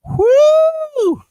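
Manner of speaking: loud, high "woo"; excited whoop. Real, not acted. Host cheering a viewer, loud whoop. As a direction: let out a long high whoop.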